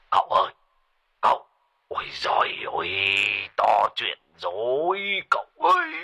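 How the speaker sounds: urgent cry to his master; old sorcerer yelling down the phone; panicked shout. A narrator's reading. Sorcerer shouting to his master on the phone, panicked. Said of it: "Thầy Phọt nói như quát".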